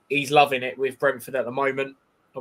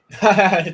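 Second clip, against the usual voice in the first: short laugh; 5 semitones higher, 8 dB louder than usual. Real, not acted. Laughs at own joke.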